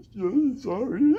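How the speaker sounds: slow, high, whimpering apology; mock near-tears. Giant bully apologizing to the mom, tearful whimper. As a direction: imitate someone about to cry. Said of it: "I thought she was gonna cry".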